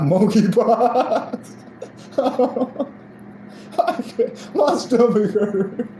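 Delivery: slow, high words squeezed out through real laughter; laughing hard. Real, not acted. Roasting his teeth to viewers, helpless laughter. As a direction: talk while you can't stop laughing. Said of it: "I got tears going in my eyes".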